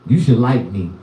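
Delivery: usual speaking voice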